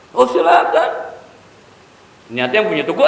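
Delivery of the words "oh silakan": a sudden high, loud "Oh, silakan"; bright and hopeful, handing it over; tailor's eager reply. Retold or imitated. The old tailor eagerly tells the shop owner go ahead.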